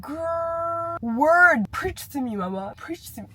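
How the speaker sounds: playful mock preacher hype; hyped-up agreement. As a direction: egg her on like a hype girl.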